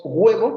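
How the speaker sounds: HUEVO opened on a marked G, "GWEH-bo"; wrong, with G. Shown as a demonstration. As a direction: say HUEVO with a clear G before the U. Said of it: "No decimos huevo".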